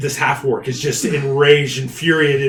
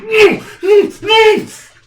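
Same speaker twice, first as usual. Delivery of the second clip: loud, high strained grunts; acted muffled struggle, climbing in pitch; struggling grunts; 10 dB louder, much slower than usual. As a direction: grunt in bursts as if being yanked away. Acts victim struggling as giant grabs him.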